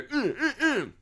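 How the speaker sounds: high-pitched short hums; comic squeaky "mm" noises; imitated amorous grunts. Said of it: "And then they go".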